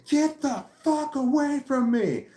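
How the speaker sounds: high, slow mock scream; retold scream. Fed-up man to woman grabbing his balloons, acted scream. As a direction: re-enact screaming it in someone's face. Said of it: "I screamed. I screamed right in her face".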